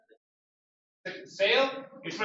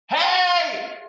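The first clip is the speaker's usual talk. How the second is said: sharp high shout, much louder; 11 semitones higher, 6 dB louder than usual. Shown as a demonstration. Teacher demonstrating a shout to the class, loud high shout. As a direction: call out Hey as loud as you can. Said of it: "But that is shout".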